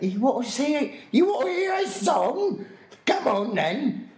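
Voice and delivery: loud, high bad Cockney shout; bad Cockney accent. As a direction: yell like a hammy Cockney hag. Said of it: "really bad Cockney accent, screaming at people".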